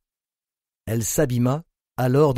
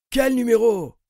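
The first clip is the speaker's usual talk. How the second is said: sneering; 11 semitones higher than usual. A narrator's reading. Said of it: "ricana-t-il".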